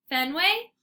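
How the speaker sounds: worried, rising call of a name; concerned call. A narrator's reading. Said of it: "sounding concerned".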